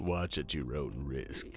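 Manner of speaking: low, slow, soft horror-host warning; mock ominous trailer voice; Vincent Price. Imitating the trailer's warning to viewers, ominous horror star. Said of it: "you have Vincent Price coming in".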